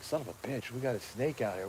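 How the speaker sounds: half-whispered alarm at a snake; hushed alarm. Retold or imitated. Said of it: "I'm like".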